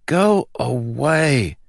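slow, drawn-out high groan of annoyance; groggy dismissal. A narrator's reading. Groggy prince to quarrelling guards, slow whiny dismissal.

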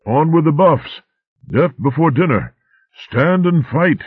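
high, loud blustering war cries; shouting. A narrator's reading. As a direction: bellow it through the smoke.

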